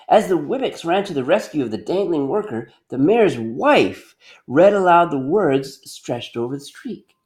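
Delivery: usual speaking voice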